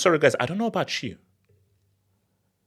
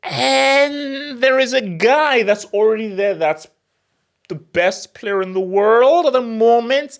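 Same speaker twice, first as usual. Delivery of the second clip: high, loud and drawn out; slow, high, loud build-up; dramatic emphasis; 8 semitones higher, 5 dB louder, slower than usual. Real, not acted. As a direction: stretch it out high for effect.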